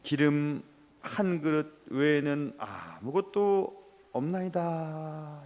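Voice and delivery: slow, drawn-out, hushed reply of a poor widow; timid widow. Acted. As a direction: drag the words out, helpless and small. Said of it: "이 여인이 얘기합니다". (선지자에게 가진 게 없다고 천천히 힘없이 답하는 과부)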